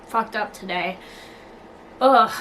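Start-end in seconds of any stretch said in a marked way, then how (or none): 2.0-2.3 loud ugh. Real, not acted. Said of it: "that was a lot of talking".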